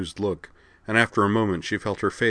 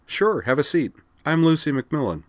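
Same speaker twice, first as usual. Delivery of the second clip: Lucy's voice; 8 semitones higher, 3 dB louder than usual. Acted. Lucy greeting Kozue.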